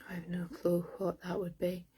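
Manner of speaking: hushed whisper to herself; soft unvoiced whisper, almost to herself. Real, not acted. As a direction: breathe it out as a private aside. Murmuring aside during reading.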